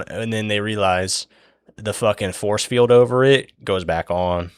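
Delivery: usual speaking voice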